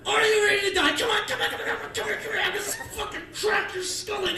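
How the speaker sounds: high, loud mock thug taunt; thugs threatening. Friends taunting their victim, loud mocking threat. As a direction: ham up a gang's death threat. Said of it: "His three friends are like".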